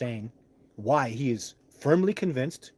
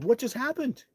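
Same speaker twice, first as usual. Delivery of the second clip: stunned disbelief; 12 semitones higher than usual. Retold or imitated. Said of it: "I was like".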